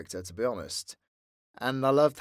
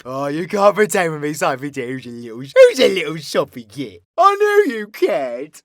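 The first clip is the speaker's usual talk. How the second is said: smother them in mocking affection; big theatrical "darling" endearments; teasing villain character; 4 semitones higher, 12 dB louder than usual. Villain character teases the creator.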